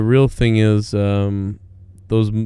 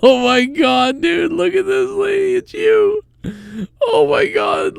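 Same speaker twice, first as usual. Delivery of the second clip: shrieking disbelief. Real, not acted. Shocked at a lookalike photo.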